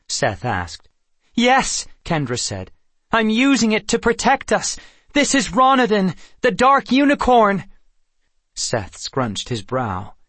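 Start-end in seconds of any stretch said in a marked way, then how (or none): 1.3-1.9 high, loud emphatic answer; urgent insistence. A narrator's reading. Kendra answering her wary brother, urgent insistence. Argues with captor before brother. 3.1-7.6 high, loud frantic explanation; urgent warning. A narrator's reading. Sister exposing the enemy to her brother, urgent warning.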